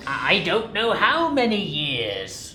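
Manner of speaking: dramatic reading; story narration. A narrator's reading. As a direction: lean into the tale. [name] reads narration as Scrooge.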